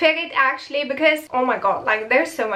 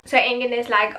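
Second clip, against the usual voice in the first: usual speaking voice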